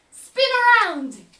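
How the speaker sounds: loud, slow and high trick order; booming ringmaster call; booming command. Pretend.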